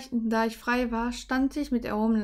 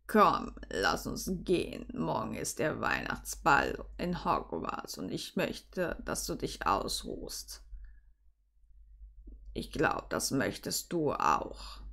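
very low, slow, soft man's voice; hushed, deep, caring voice; gentle Severus; 8 semitones lower, 6 dB quieter, slower than usual. Acted. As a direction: murmur it deep and slowly, coaxing. Severus gently urges her to rest.

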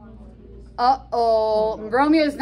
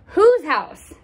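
higher, louder demand; pressing question; 7 semitones higher, 6 dB louder than usual. Acted. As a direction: push him for the answer. Romeo presses the servant.

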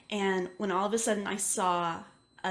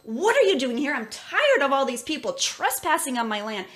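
angry yelling spirit; 7 semitones higher, 6 dB louder than usual. Retold or imitated. Old man's ghost yelling at a trespassing child, furious shout. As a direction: bark it at the intruding child. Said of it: "got so angry at me".

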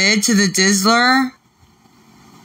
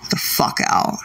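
usual speaking voice

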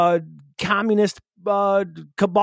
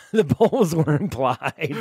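laughing through the words, slow; slower than usual. Real, not acted. Echoing his cohost's absurd line while laughing. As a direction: repeat it while cracking up.

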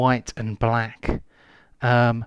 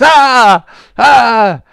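two high, loud shrieks; full-voiced "aah" screams; scream on falling; 11 semitones higher, 13 dB louder than usual. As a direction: cry out as you hit the ground. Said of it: "falls onto the ground and says".

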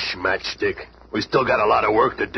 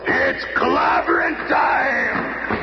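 long, slow, shouted battle cry; 13 semitones higher, much slower than usual. Acted. Ben charging the foes, a drawn-out shouted battle cry. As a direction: roar it out to launch the fight.

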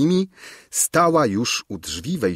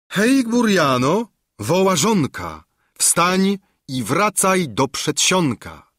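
higher, louder, slow sing-song rhyme; mocking summons; 9 semitones higher, 3 dB louder than usual. A narrator's reading. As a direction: call the footman like a spell. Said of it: "mówiąc". (wyższa, wolna, rytmiczna inkantacja)

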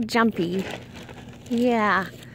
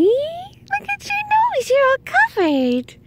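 high slow pet voice. Real, not acted. Doting on the pet.

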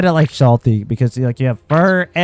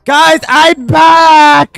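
loud, slow, high call; excited announcement; 10 semitones higher, 8 dB louder, much slower than usual. Real, not acted. Returning from freeze.

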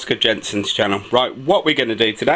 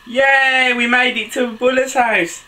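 loud, high excited yay; triumphant cheer; 11 semitones higher, 4 dB louder, slower than usual. Acted. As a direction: cheer the arrival with pitch held high. Story arrival celebrated.

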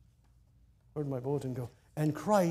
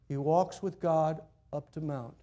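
ordinary talk

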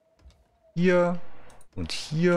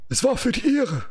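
gasped out much higher, as if wounded. A narrator's reading. Wounded Edgar gasping to the stranger. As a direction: pant it out like a bleeding man. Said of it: "keuchte Edgar".

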